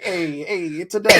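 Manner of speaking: a hint of a cheesy Italian accent; lightly put-on Italian accent; bad Italian accent. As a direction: toss it off in a jokey Italian accent. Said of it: "exactly that shitty Italian accent".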